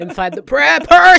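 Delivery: high, slow, very loud whoop; hyped-up shout. Real, not acted. Co-host hyping up her friend's advice, hyped-up shout. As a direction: holler it in delighted teasing, drawn out.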